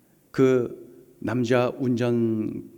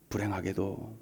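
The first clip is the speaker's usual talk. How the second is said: ordinary talk, nothing marked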